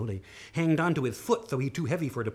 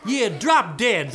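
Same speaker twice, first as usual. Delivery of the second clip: loud, slow and punched; rude retort; 5 semitones higher, 7 dB louder, much slower than usual. Acted. Cursed sister answering the bear, loud slow rude retort. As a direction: hit every word with insolence.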